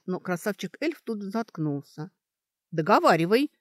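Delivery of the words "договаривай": loud, insistent demand; surprised command. A narrator's reading. Student ordering the elf to finish, surprised. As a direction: order it, taken aback. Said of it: "велела удивлённая Сашка".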